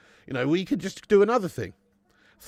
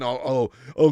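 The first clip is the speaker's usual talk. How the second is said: ordinary talk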